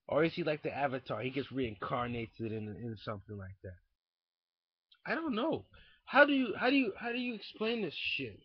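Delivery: ordinary talk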